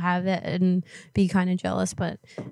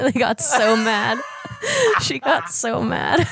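laughing; 4 semitones higher, slower than usual. Real, not acted. Laughing after the prank.

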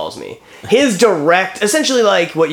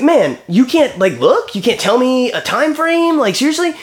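higher, slow incredulous complaint; retold exasperation; 7 semitones higher than usual. Caller pressing adjuster for a time, incredulous. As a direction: demand a time frame in disbelief.

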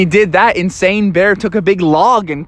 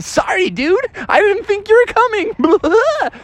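high, smug mock apology; fake-sorry bear.

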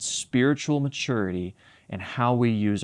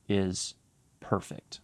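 hushed on perfect; 6 dB quieter, slower than usual. Shown as a demonstration. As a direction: drop to a breathy hush on "perfect". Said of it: "I want you to hear this next word".

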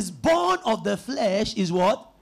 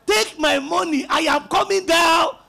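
mock shout, pitched up; alarmed passenger's protest; 5 semitones higher, 4 dB louder than usual. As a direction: call out like a passenger demanding to get off. Acted passenger demanding to leave plane.

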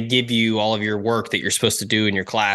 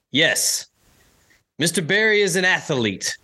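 sarcastic coach; 8 semitones higher than usual. Retold or imitated. Coach sarcastically mocks him.

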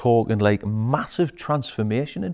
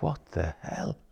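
softer, low stunned client; quiet disbelief; 6 semitones lower, 10 dB quieter than usual. Retold or imitated. Coach quoting a stunned client, quietly. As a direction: mutter in disbelief. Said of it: "And they're like".

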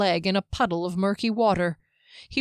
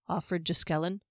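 ordinary delivery, nothing marked

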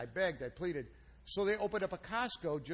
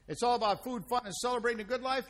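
upbeat, louder show-host intro; announcer intro; 4 semitones higher, 4 dB louder than usual. Acted. Host opening the Chef and a Fat Man show, upbeat announcer voice.

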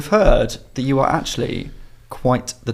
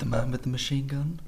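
hushed; 11 dB quieter than usual. Real, not acted. Quoting a lyric, hushed.

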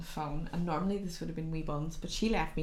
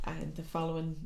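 ordinary talk

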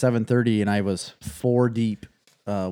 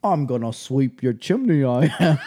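hammed-up Cockney accent; Cockney chimney sweep; 3 semitones higher, 3 dB louder than usual. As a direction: play a comic British chimney sweep. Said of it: "did the chimney sweep in".

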